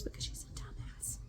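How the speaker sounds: a hushed, scornful aside; whispered insult. Real, not acted. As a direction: whisper it under your breath. Insulting stepdaughter under her breath.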